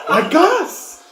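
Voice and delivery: put-on high, loud child voice; excited. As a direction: chirp it gleefully.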